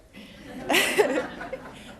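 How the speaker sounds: breathy little chuckle. Real, not acted. Laughs at son's opinions.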